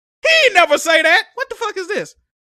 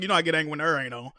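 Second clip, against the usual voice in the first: fast mutter; 10 semitones lower, 8 dB quieter, much faster than usual. Real, not acted. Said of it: "start raging".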